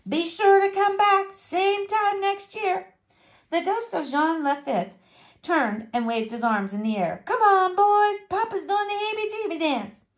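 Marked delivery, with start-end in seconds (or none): 0.1-2.9 high, drawn-out call; shouted farewell. A narrator's reading. Pirate captain to Dipper and others, high shouted farewell. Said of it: "shouted back to Dipper and the others". 7.2-10.0 high, sustained holler; rallying call. A narrator's reading. Ghost captain to his boys, high rallying call.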